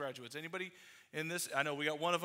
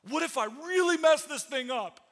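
anxious worry; 7 semitones higher, 7 dB louder than usual. Retold or imitated. Voicing anxious believer's fear.

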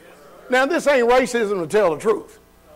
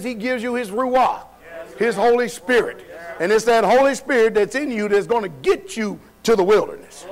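forceful preaching; 3 semitones higher than usual. Real, not acted. Preacher challenges congregation on who cares, forceful preaching. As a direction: preach it out with punchy emphasis.

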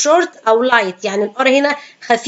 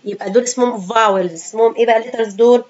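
normal talking voice

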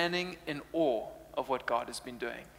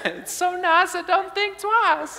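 much higher, slow laughing sing-song; intercom jingle; 14 semitones higher, 4 dB louder, slower than usual. Retold or imitated. Preacher reprises the store announcer's jingle, high and slow. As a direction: croon the jingle like the intercom lady.

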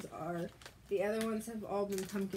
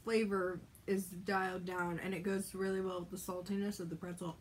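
talking between bites; chewing while talking. Real, not acted. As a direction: mouth partly full. Seen 2: Left woman holds pretzel snack, mouth working, bites near end.

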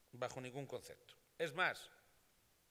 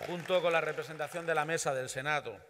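louder, higher, project to the room; loud formal thanks; 3 semitones higher, 10 dB louder than usual. Real, not acted. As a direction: thank the government proudly. (agradecimiento en voz alta)

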